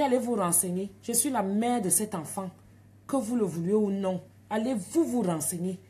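put-on loud, firm, African-accented; angry telling-off. Mother ordering the midwife to check, loud and firm. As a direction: put her firmly in her place. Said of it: "Sors ton accent africain".

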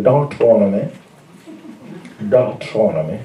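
preacher says Deuteronomy in his changed accent. Shown as a demonstration. Preacher shows the congregation his accent, Deuteronomy with a changed accent. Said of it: "I'm starting to change my accent a little bit".

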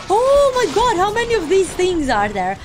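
high loud outcry, easing down; alarmed amazement. Real, not acted. Player seeing many creatures, high loud alarmed outcry. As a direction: exclaim in dismay, starting high.

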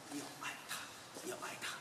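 hushed, breathy plea; gentle whisper. Real, not acted. Pastor urges the congregation to love, whispered. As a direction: whisper it tenderly. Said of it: "gentle exhortation".

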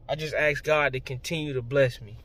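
ordinary talk, nothing marked

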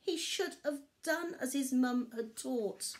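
ordinary delivery, nothing marked

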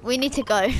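usual speaking voice

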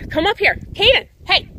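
loud high shout to the dog; shouting across the yard; calling out loud. Real, not acted. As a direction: yell for the dog to come. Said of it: "Sorry if I'm screaming at you".

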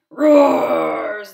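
loud, slow roar; loud roaring lion-cub invitation. Acted. Cub roars invitation to dance.

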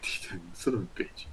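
fast unvoiced swear under breath; whispered curse. Real, not acted. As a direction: hiss the curse to yourself. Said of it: "groans at the roll".

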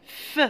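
"F" as a soft, isolated fff; letter sound. Shown as a demonstration. Teacher repeating what remains for children, "F" as a bare fff. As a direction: sound out "F" quietly as fff, without naming the letter. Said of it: "Il reste".

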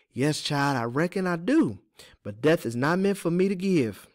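plain reading voice

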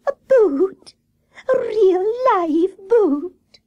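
very high, stretched-out squeal of wonder; high-voiced squirrel marvelling; excited call. A narrator's reading. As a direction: squeak it slowly in high amazement. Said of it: "called Squirrel".

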